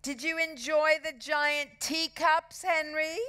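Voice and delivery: high, mock-sweet needling; smug girl's false concern; smirking. A narrator's reading. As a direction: tease with a smirk, lingering on the end.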